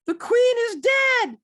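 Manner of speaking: high, loud, slow shrieking proclamation; Monty Python shout. Retold or imitated. Passerby shrieking the news in the street, Monty Python style. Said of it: "She sounded like a Monty Python".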